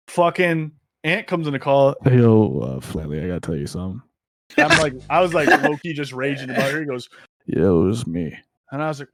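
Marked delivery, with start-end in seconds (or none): 2.0-4.0 low guy voice. Retold or imitated. AJ voiced in retold story. 7.5-8.4 low confessing voice. Retold or imitated. AJ confesses in retold story.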